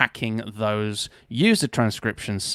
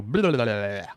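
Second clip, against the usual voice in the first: rapid tongue-rolling babble; mock stream of babbled speech; babbled blah-blah. Shown as a demonstration. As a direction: let the words spill out as gibberish. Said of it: "I naturally just go".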